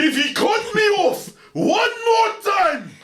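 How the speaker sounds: high, slow screaming threat; furious shout. Acted. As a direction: scream it at the top of your lungs. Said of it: "I can't hear you".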